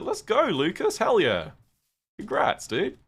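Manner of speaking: high, loud cheering; excited cheer. Real, not acted. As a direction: cheer for a friend's big news. Cheering a viewer's coming out.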